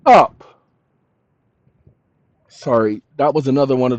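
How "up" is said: sharp, slow angry bark; angry snap. Real, not acted. Snapping at an interrupting caller, angry. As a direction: shut them up with real anger.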